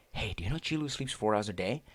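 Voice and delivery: mock-secretive coworker gossip; hushed. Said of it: "They would say it in this hushed voice".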